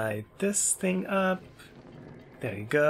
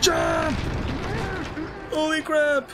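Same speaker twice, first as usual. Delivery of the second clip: urgent shout; 13 semitones higher, much slower than usual. Real, not acted. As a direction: yell it mid-leap. Chase, urgent call.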